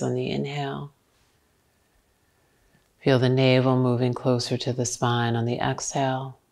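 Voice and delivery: low, softened yoga teacher voice; guided relaxation. Real, not acted. Leads a breathing relaxation.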